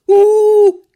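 long loud high boo; drawn-out booing. A narrator's reading. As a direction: boo the performers from the crowd. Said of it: "протянул кто-то".

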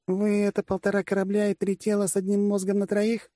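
mocking question in a high female voice; глумление. A narrator's reading. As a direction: jeer at him, pitched high throughout. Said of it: "продолжала глумиться генералиссимус". (глумливо, высоким голосом)

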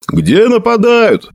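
high, drawn-out shouted question; hoarse shout. A narrator's reading. Horseman demands where the attack is, shouting hoarsely. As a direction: yell it across the darkness. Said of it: "хриплым голосом выкрикнул Сэджин Литси".

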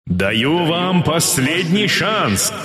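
very slow megaphone warning; loudspeaker voice. Acted. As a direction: drag out the final warning. Enemy loudspeaker final warning.

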